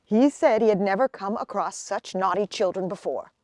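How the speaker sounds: usual speaking voice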